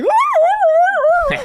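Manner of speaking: very high, loud dog yelps; doodle yelping. Retold or imitated. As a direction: yelp like a bitten dog. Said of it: "the doodle was like".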